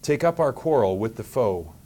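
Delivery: ordinary talk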